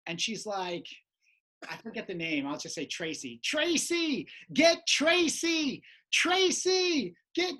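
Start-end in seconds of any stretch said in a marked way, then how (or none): 3.5-7.5 high, insistent mock yell; imitated yelling. Lady calls from back of room, imitated yelling. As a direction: call out from the back row. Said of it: "and she's yelling".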